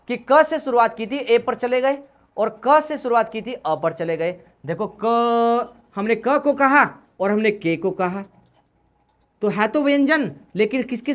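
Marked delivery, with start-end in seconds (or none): 5.0-5.7 क held long so the अ sound rings through; long drawn-out क. Shown as a demonstration. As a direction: stretch क slowly, letting the vowel अ come out. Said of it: "लंबे समय तक हम लोग क बोले". (क को धीरे-धीरे लंबा खींचकर बोलें)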